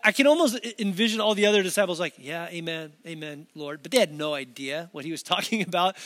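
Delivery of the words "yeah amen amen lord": softly going along; hushed, noncommittal yes-man; clueless agreement. Acted. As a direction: murmur along as if you understand. Imitates disciples nodding along clueless.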